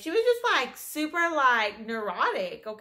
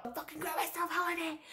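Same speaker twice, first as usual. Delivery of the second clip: high, softened mock yell; retold yelling; 5 semitones higher, 8 dB quieter, faster than usual. Coworker yells at Holiday across the club, high hushed mock yell. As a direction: imitate her yelling, pitched up but kept quiet. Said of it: "like, yelling all her, all the way out".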